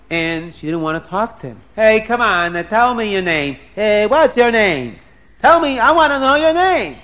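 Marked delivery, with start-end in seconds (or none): 1.7-7.0 loud, higher-pitched, insistent questioning; pushy badgering. Prince pesters Dina who won't talk, pushy badgering. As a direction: keep pestering for an answer.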